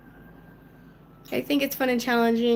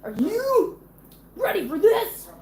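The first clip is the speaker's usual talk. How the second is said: higher and louder, drawn out; hyped-up announcer; 8 semitones higher, 5 dB louder than usual. Acted. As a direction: build it up like a game-show host. Teasing husband, hammed-up repeat.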